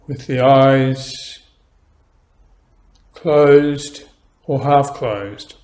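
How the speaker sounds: slow, calm meditation guidance; guided meditation. Acted. Teacher guiding beginners through posture, slow calm meditation voice. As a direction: ease the listener into stillness, slowly.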